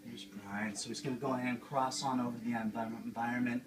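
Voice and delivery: gentle, soothing painting-show host voice, a Bob Ross send-up; Bob Ross Junior. Acted. Host of a painting show, talking to viewers at home: soothing Bob Ross voice. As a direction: coax the canvas along like a dreamy TV painter.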